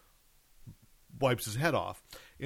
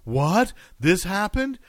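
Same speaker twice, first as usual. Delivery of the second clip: higher, louder incredulous questions; shocked clickbait reader; 7 semitones higher, 8 dB louder, slower than usual. Acted. Podcaster imitating a reader falling for bait, shocked. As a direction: act out a stunned reader's disbelief. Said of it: "somebody picks that up and goes".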